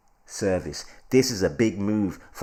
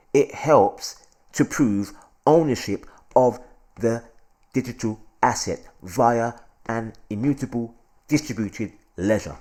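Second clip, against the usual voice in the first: normal talking voice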